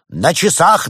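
high, loud start of a proclamation; ringing teen call; shouted announcement. A narrator's reading. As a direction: begin calling out the time. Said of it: "начал было подросток".

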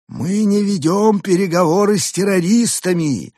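high put-on alien voice; firm alien refusal. A narrator's reading. Arthur's alien voice. (высокий голос пришельца)

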